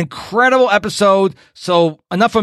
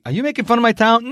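higher, quick mock-offended townsperson; offended local; 7 semitones higher, faster than usual. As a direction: challenge it like a touchy Southern local. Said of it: "someone's listening to this and they're gonna say".

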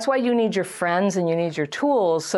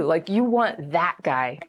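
ordinary talk, nothing marked

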